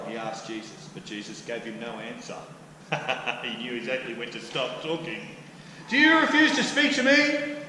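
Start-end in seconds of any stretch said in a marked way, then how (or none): 5.9-7.6 higher and louder, pressing hard; a governor losing patience; exasperated demand. Acted. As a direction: Pilate demanding an answer from Jesus. Pilate confronts silent Jesus.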